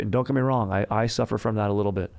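ordinary delivery

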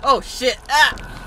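sudden frightened yelp; startled scream. Real, not acted. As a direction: jump out of your skin. Jump scare in horror game.

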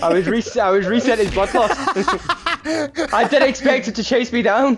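laughing explanation. Real, not acted. Player explaining the chase to teammate, laughing. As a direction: explain it while cracking up.

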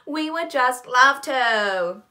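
loud high laugh into slow gushing; fake delight. Narrator accepting brother's dreaded invitation, loudly fake delight. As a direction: overdo the joy to hide dread.

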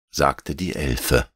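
ordinary delivery, nothing marked

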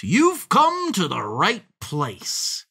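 slow, high gloating fox; crying out. A narrator's reading. Fox welcomes his dinner, crying out gleefully. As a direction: draw it out with relish. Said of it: "cried the fox".

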